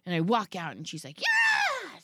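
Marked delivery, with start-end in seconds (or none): 1.2-2.0 very high mock scream; high imitated screaming yell; child's scream. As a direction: shriek it like a furious kid. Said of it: "screaming at me".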